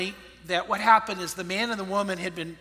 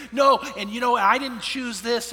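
louder, insistent retold "no"; defiant protest; 5 semitones higher, 8 dB louder than usual. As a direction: push back like an upset teenager. Retold quarrel, daughter rejects stepfather.

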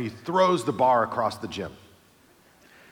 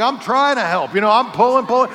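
higher, louder straining protest; pulling with all he's got; panicked strain; 8 semitones higher, 6 dB louder than usual. Retold or imitated. Helper answering the pinned lifter, higher, louder panic.

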